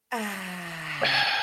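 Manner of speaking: soft long breath out on "ah"; cleansing exhale. Shown as a demonstration. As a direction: blow it all out softly. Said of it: "let's take a deep breath in".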